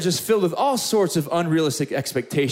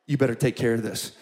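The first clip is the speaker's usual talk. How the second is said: imitated stern warning; 7 semitones lower, 4 dB quieter than usual. Wife's parting order before shutting door.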